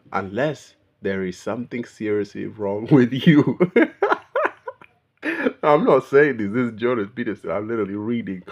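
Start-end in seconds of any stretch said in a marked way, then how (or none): 3.6-4.7 sudden laugh in a very high pitch; high squealing laugh. Real, not acted. Laughs at her own remark.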